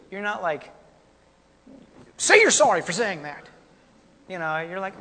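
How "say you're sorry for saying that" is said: mock parent scold. Parent scolding child, retold.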